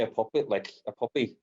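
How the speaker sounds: ordinary talk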